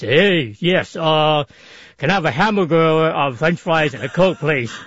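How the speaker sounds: put-on voice trying to sound manlier. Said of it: "I would try to speak a little bit lower voice".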